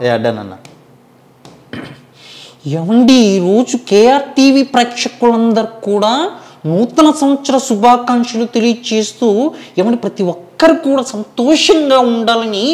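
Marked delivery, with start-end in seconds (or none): 2.8-12.6 a higher put-on voice wishing viewers happy new year; mimicked greeter. Mimic greets the channel's viewers in a higher put-on voice. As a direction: pitch it up like a courteous public figure.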